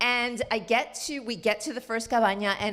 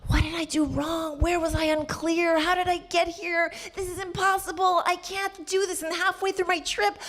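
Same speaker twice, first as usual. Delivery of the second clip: despairing inner voice; 9 semitones higher than usual. Retold or imitated.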